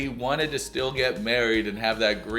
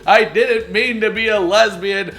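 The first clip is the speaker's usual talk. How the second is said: much higher, louder hammed-up sobbing; mock crying plea; 9 semitones higher, 7 dB louder than usual. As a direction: beg dramatically through fake tears. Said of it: "freaking out and crying and just going".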